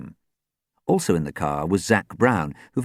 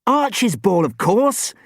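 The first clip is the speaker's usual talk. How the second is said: high, loud, impatient snap; sharp high exclamation, no real hiss; sharp retort; 14 semitones higher, 5 dB louder, slower than usual. A narrator's reading. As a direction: retort sharply to someone being slow. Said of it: "Sam hissed sharply".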